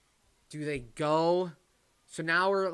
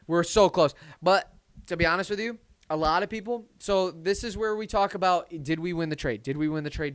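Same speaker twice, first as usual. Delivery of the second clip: excited; 5 semitones higher, 9 dB louder than usual. Real, not acted. Hyped over the draft.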